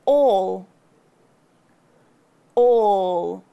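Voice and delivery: "All" loud, lips rounded, chin back then up. Shown as a demonstration. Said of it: "Notice how I close my chin".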